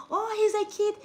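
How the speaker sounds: mock the excusing crowd; indulgent bystander. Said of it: "people be like".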